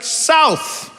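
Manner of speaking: high, drawn-out single-word answer; imitated Austin local. Austin local answering where they're from, high mock-grand. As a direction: announce it grandly as a complete answer. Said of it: "people are just like".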